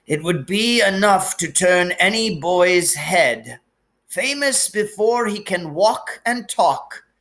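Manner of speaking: put-on gentle sorrowful old wizard; wise old headmaster, lightly voiced. A narrator's reading. Dumbledore figure held.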